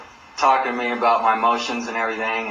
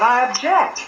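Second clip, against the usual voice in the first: mock indignant objection; 11 semitones higher, 4 dB louder than usual. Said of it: "And I said".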